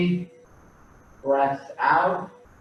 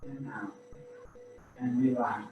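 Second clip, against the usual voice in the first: gently pace the class's breaths, quieter; guided breathing cues; 2 semitones higher, 7 dB quieter than usual. Acted. Instructor pacing the class's breaths, soft and calm.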